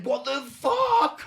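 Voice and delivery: high, loud, stretched-out cry; panicked disbelief. Retold or imitated. Driver reacting to the hijacker's order, in acted panic. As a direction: act out a panicking driver, dragging it out.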